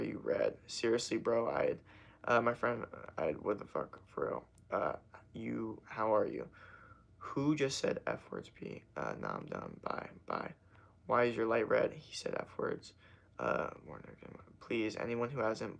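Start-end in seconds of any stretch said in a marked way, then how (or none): none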